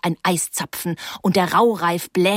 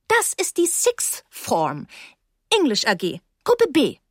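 pointed tone; 5 semitones higher than usual. A narrator's reading. Teacher Miss Becks informing an intruder, pointed. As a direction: spell it out pointedly. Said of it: "in angespitztem Ton".

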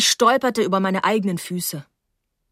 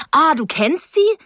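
high, louder exclaimed question; surprised; 8 semitones higher, 5 dB louder than usual. A narrator's reading. Girl finding that the two know each other, surprised. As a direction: burst out with the question, taken by surprise. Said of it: "fragte ich überrascht".

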